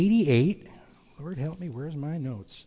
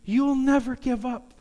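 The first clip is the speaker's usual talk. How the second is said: put-on high, earnest child's voice; little boy voice; 8 semitones higher than usual. Trapped son telling his father he kept faith, high child voice. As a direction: speak as a trusting little boy. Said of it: "And the little boy says".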